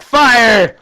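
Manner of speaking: high, loud barked command; shouted order. Retold or imitated. Commander calling his line to fire, loud shout. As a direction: yell it down the battle line.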